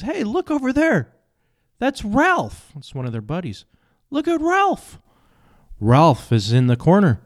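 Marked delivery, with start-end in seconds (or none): 0.0-5.0 very high squeaky penguin; excited penguin voice. Acted. Penguins spot Ralph flying.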